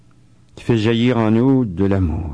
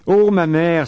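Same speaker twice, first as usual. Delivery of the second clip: a dying nun's joyful outcry; joyful exclamation; exclaiming; 6 semitones higher than usual. A narrator's reading. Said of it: "s'écria-t-elle".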